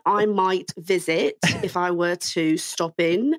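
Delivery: put-on slow, posh-leaning British accent. Host asks for an ode to the Queen, slow British accent. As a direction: play the Queen's English, slowly. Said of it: "in your best British".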